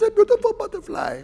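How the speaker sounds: high, quiet German caterpillar voice; Heimlich's German accent. Retold or imitated. Preacher imitating Heimlich to the congregation, in a high German accent. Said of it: "That's how he talks. He has this accent".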